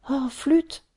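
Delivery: a small huff of frustration; annoyed oh flûte. Acted. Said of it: "dit-elle".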